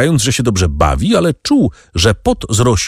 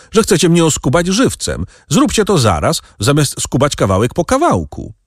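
bitter shout; 8 semitones higher than usual. A narrator's reading. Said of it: "krzyknął Danglars z nutą goryczy".

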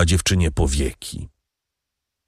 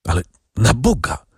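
cried out; 2 semitones higher than usual. A narrator's reading. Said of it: "zawołała dziewczyna".

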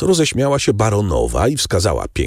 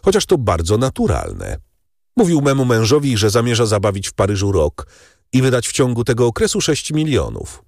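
lightly voiced Albert; 9 semitones higher than usual. A narrator's reading. Albert voiced in novel reading.

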